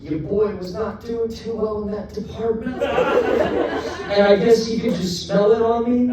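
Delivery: ordinary talk, nothing marked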